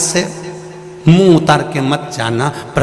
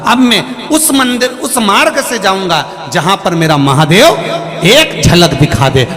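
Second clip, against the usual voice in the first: fervent preaching; 4 semitones higher than usual. Acted. Preacher to devotees, building to a fervent shout. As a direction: cry out for a glimpse of God. Preacher rising to full force.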